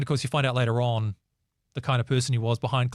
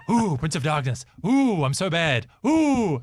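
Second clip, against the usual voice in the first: slow, hammed-up "ooh" rising into a high loud squeal; mock spooky taunt; 3 semitones higher, 3 dB louder, slower than usual. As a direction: tease the would-be dark prince. Mocking [name]'s spooky persona.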